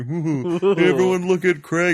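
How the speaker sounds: loud, high, drawn-out taunt; hammed-up teasing announcement; mock jeering. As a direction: call everyone over to jeer. Crew mocking Craig, acted.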